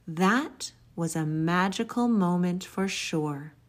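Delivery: ordinary delivery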